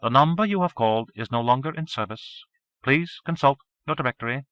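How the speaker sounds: automated phone message voice; mechanical recorded voice. A narrator's reading. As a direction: read like a recorded operator. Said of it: "a mechanical voice told me".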